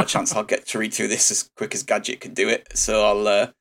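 normal talking voice

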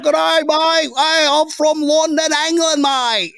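high, drawn-out bad English accent; mock English accent. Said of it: "that's my English accent".

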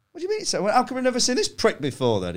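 high, exasperated comic protest; mock indignation, pitched right up; retold indignant protest. Acting his indignant argument with bear.

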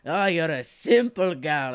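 slow, drawn-out mock-Scottish; bad Scottish accent. Dwarf blacksmith sizing up a customer, bad Scottish accent. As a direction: put on an unconvincing Scottish burr. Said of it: "that's a great Scottish accent".

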